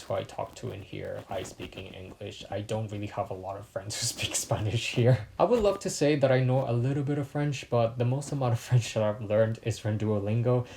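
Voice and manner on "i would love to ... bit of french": ordinary talk, nothing marked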